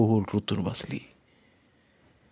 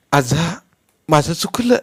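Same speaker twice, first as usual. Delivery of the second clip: crying; 6 semitones higher, 5 dB louder than usual. A narrator's reading. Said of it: "म्हणत रडत".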